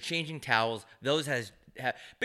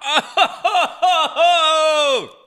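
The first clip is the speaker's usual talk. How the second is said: high, loud burst of laughter; 15 dB louder than usual. Real, not acted. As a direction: howl with laughter. Reacting to strong shot.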